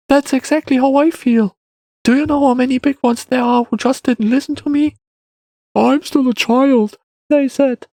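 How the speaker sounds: put-on high-pitched aggrieved boy; complaining child. A narrator's reading. Boy complains big ones ignored him, high child voice.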